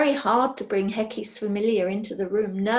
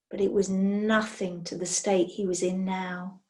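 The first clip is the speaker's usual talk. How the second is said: ordinary delivery, nothing marked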